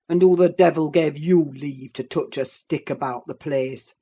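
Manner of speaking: low, loud angry demand; father-in-law demands, low and loud in surprise; surprised demand. A narrator's reading. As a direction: bark it, outraged. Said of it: "demanded her father-in-law, much surprised".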